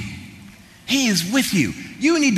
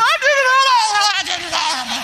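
mock panicked yell, much higher; retold scream. Schoolboy confessing to the nun, mock high yell. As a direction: imitate a kid cracking, shouting a confession. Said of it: "somebody starts screaming".

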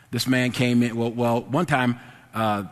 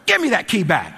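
raised, higher-pitched demand; angry retelling; 6 semitones higher, 4 dB louder than usual. Retold or imitated. Angry clerk confronting a man who grabbed the key, stern demand. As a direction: act out an angry order. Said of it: "that made me mad".

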